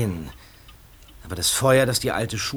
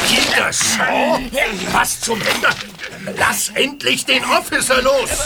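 angry; 8 semitones higher, slower than usual. Acted. Police breaking up a fight.

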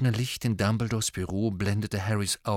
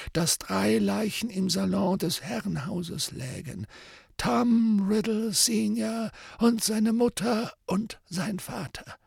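put-on old wizard voice; 10 semitones higher than usual. Dumbledore's voice.

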